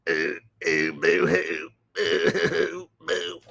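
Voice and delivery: hammed-up fake sobbing noises; boo-hoo it like a mocked crybaby; mock boo-hoo crying. Streamer mocks the crying Alex Jones with fake sobs. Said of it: "Sits in a car like a pussy and cries".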